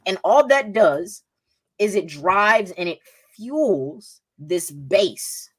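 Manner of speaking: ordinary talk, nothing marked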